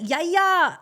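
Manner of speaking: put-on high, loud little boy cheering in an Arabic accent; imitated child fan's high-pitched cry. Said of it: "didn't he have the sweetest voice, like a proper Arabic voice, accent".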